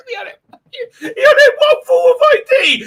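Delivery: high, near-crying cry of disbelief; incredulous. Real, not acted. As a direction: squeal in disbelief. Incredulous retelling.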